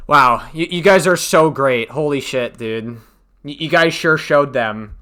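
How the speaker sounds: loud sarcastic mock praise. Real, not acted. Streamer sarcastically praises the Twitter brigade, loud and mocking. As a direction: praise them with heavy sarcasm.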